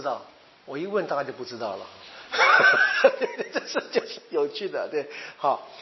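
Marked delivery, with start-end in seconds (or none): 2.2-3.5 a short, breathy burst of laughter; real laugh. Real, not acted. Teacher laughing at his own joke to the class, a breathy laugh.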